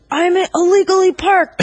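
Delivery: whiny customer. Retold or imitated. Mocking the parking customer, high whining plea. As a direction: whine it like a frantic complainer. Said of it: "always like".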